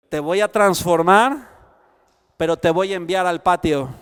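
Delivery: loud, driving sermon voice; preaching at full force. Acted. Preacher calling the church to the altar, fervent and loud. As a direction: proclaim it over the congregation, building.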